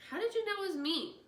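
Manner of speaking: eager question. A narrator's reading. Tobin asks his dad urgently, excited. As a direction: burst out the question.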